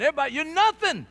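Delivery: loud, high, fast accusing shout; shouted put-down. Acted. Inner voice of rejection condemning you, shouted high. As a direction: yell the verdict at the listener.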